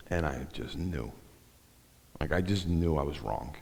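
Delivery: soft confession of a hard truth; quiet realization. Real, not acted. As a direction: let it sink in quietly. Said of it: "overwhelming sense and feeling".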